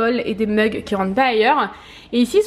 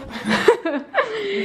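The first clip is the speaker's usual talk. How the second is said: high giggling laugh; burst of laughter; 7 semitones higher than usual. Real, not acted. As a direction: break into laughter.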